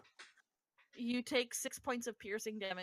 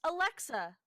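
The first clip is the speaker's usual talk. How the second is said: raised, higher voice command; calling a voice assistant; 3 semitones higher, 6 dB louder, slower than usual. Real, not acted. Giving a voice app a command, calling out. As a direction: call it out firmly to the device.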